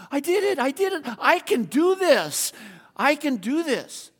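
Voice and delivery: put-on high, loud child cheering, slowing on the last lines; excited child. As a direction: shout it out like a kid who just made the jump. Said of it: "just all excited as could be".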